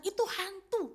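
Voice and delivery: high, panicked cry: itu hantu; frightened scream. Retold or imitated. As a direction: shriek it out in terror. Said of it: "Waktu mereka berteriak".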